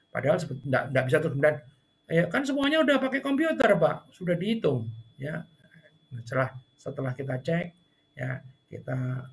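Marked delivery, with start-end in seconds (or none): 2.1-4.9 high, brushing-off retort; dismissive staff. Retold or imitated. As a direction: wave the complaint away as settled.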